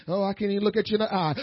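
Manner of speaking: fast, tossed-off mock mumble; quick mock timidity. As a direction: rattle off a shy excuse. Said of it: "being broken don't mean".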